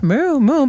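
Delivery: a quick cartoon cow; cow moo. Shown as a demonstration. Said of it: "moos and stuff".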